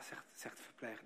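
usual speaking voice

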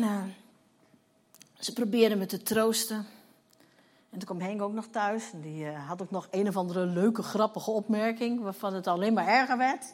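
ordinary delivery

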